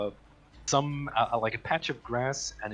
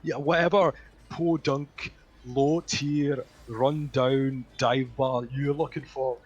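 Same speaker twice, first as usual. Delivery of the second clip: put-on snooty old doorman; 4 semitones higher than usual. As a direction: look down your nose at the lost bumpkin. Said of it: "and he goes".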